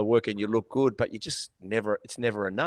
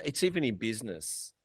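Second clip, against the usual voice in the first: ordinary talk, nothing marked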